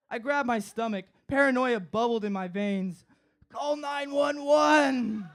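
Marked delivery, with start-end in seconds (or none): 3.5-5.3 high, slow, drawn-out plea; desperate plea. Retold or imitated. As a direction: beg them to call help. Panicking, begging for ambulance.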